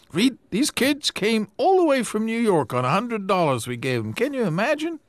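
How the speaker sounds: he can't believe they made it; amazed newspaperman. Acted.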